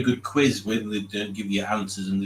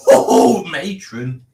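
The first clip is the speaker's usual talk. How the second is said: mock shocked; 7 semitones higher, 10 dB louder, much slower than usual. Real, not acted. Comic exclamation at a comment.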